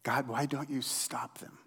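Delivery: whispered, pleading why; frustrated whisper. Acted. Pastor voicing Habakkuk's complaint to God in a frustrated whisper. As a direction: push the frustration out in a rasping whisper. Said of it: "the frustration in Habakkuk's voice".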